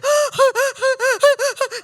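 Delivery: loud, high, repeated mock gasping; hammed-up hyperventilating with excitement; can't-breathe gasps. Imitating the lady meeting their daughter, breathless gasps. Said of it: "she can't breathe".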